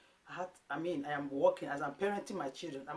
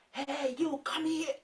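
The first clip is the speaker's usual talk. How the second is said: high, loud mock yell at the kids; imitating her yelling; 11 semitones higher, 6 dB louder, slower than usual. Mom reenacting how she used to yell at her son, loud mock yell. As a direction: play the old yelling mom calling her son over. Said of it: "I used to be a yeller".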